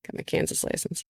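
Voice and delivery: breathy sneaky whisper; confiding under the breath; whispered aside. Real, not acted. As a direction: drop to a secret whisper. Whispered aside about new license.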